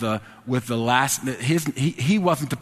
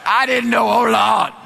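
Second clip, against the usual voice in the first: high, loud mock wail; hammed-up horrified pleading; comic panic; 9 semitones higher, 7 dB louder, slower than usual. Clerk horrified at his mistake, mock panicked wail.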